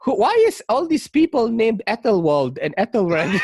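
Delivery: put-on mom in a Filipino accent, laughing; confused Filipino mom voice. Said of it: "In a Filipino accent".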